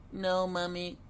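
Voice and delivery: put-on a baby duck too frightened to move; tiny frightened duckling voice; scared duckling. A narrator's reading. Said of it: "cried Little Quack".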